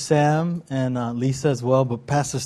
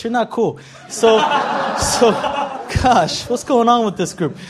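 higher, chuckling through the teasing; laughing; 6 semitones higher than usual. Real, not acted. Pastor teasing the congregation about BFFs, laughing. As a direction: laugh it off while ribbing the crowd.

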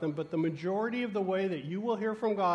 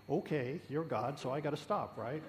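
grudging okay; 4 semitones lower, 7 dB quieter than usual. Retold or imitated. Grudgingly obeying God.

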